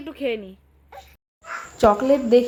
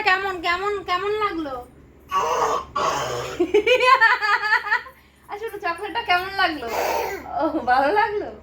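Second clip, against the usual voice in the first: laughing; 5 semitones higher than usual. Real, not acted. Laughing while asking daughter.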